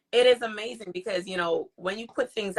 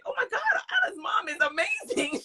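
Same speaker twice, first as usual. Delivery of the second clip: high-pitched retold awe; amazed gushing; 14 semitones higher, 3 dB quieter than usual. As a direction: gush in acted-out astonishment, pitched well up. Retells amazed reaction.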